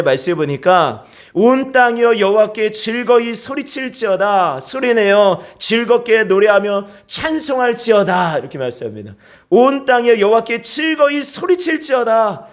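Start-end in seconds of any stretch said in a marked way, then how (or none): 1.3-8.4 high, drawn-out psalm declaration; scripture proclamation. Real, not acted. Preacher reading the psalm to the congregation, proclaiming. 9.5-12.5 high, raised psalm cry; shouted proclamation. Shown as a demonstration. Preacher urging congregation to shout praise, calling out. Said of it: "소리치는 거예요".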